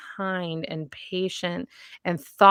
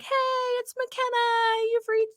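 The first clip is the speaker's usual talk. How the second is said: imitating little daughter. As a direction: play a five-year-old recording a promo. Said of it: "she was like".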